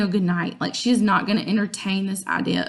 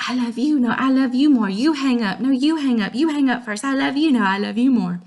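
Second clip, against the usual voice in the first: lovey-dovey couple banter; 3 semitones higher than usual. Retold or imitated. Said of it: "It's like".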